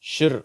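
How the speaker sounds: "ஷிர்" as one blended shr; SHR sound. Shown as a demonstration. Teacher naming the cluster sound, "ஷிர்" as shr. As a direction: say "ஷிர்" as sh running into r. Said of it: "அப்படிங்கிற உச்சரிப்பு".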